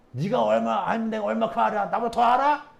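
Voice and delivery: loud, offended challenge; indignant scoff. Retold or imitated. The Pharisees scolding the healed man, indignant. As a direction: sneer down at him in indignation.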